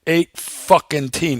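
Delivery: slow, drawn-out mock disbelief; growing anger. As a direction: imitate a factory man getting worked up, slowly. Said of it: "He's starting to get angry now".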